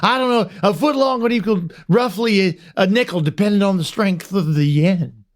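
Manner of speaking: high, rambling sportscaster caricature; Will Ferrell as Harry Carey. Retold or imitated. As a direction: muse nonsense like a daffy announcer. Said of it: "I wanna do Will Ferrell's version of him".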